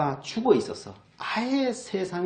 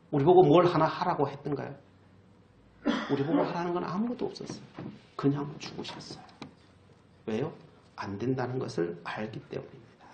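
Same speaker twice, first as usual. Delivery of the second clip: usual speaking voice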